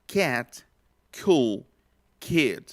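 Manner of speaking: put-on "cat, cool, kid" with the k released in a burst of air; aspirated k. Shown as a demonstration. Teacher reads the example words with aspirated k. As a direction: aspirate each k clearly. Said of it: "Otra vez".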